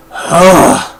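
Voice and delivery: exasperated groan. Real, not acted. Reviewer fed up with a repeated number, groaning. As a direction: let out a fed-up 'ugh'.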